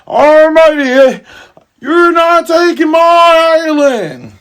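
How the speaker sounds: put-on old man challenger. An old man confronts drinkers at the bar, slow high and loud. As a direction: rise up and declare it slowly like a stubborn old man. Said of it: "an old man comes up and says".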